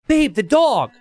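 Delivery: high excited "Babe, the dog!" with a lift on dog; excited call. Retold or imitated. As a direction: call out, suddenly eager. Fiance spotting the dog.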